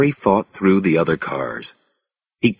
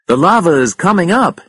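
raised, high-pitched alert; urgent warning; 11 semitones higher, 5 dB louder than usual. A narrator's reading. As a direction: sound the alarm. Warning of rising lava.

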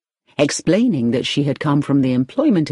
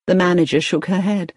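ordinary delivery, nothing marked

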